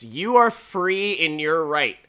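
ordinary talk, nothing marked

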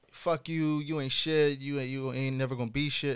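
ordinary talk

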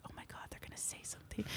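fast panicked whisper. Retold or imitated. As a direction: breathe it out, no voice. Quiet film set, stomach rumbling.

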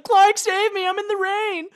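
very high, mocking damsel in distress. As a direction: squeal it like a helpless damsel. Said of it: "She was like".

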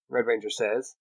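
plain reading voice

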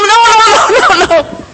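high, loud mock scream; grandmother screaming no. Grandmother stopping the men chasing round the table, mock scream. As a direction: imitate grandma stopping the fight. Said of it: "my grandmother would scream".

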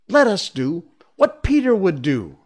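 high, loud and resolute; rally the gang in a boyish shout; eager group cry. Acted. Boys rallying each other.

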